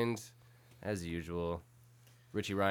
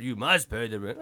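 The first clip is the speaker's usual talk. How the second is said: louder sneering melodrama villain line; sneering panto villain; 6 dB louder than usual. Retold or imitated. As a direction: lean in like Snidely Whiplash. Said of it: "Snidely whiplash".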